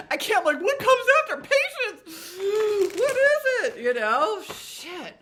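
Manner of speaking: high, loud, overwhelmed squealing; excited squeal. Real, not acted. Tarot reader squealing at her viewers over the next card, excited. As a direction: burst out in giddy disbelief.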